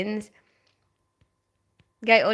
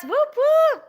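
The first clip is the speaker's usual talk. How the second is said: high squealed whoop, whoop; a quick, giddy high cheer; 3 dB louder than usual. Real, not acted. Streamer cheers to chat about Christmas, squealing.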